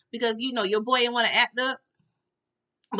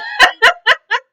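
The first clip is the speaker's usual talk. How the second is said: shrill laugh; 14 semitones higher, 12 dB louder than usual. Real, not acted. Laughs at the scene.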